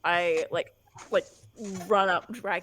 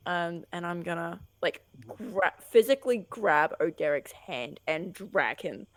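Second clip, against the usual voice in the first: usual speaking voice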